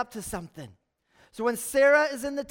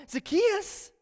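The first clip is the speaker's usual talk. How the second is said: calling by name; 7 semitones higher than usual. Acted. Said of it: "Jesus calls him by name".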